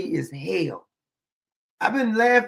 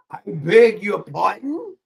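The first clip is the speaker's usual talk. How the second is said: slow mock British query, rising at the end; put-on British affront; indignant British; 4 dB louder, slower than usual. Acting out indignation over oysters, mock British. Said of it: "sounding British".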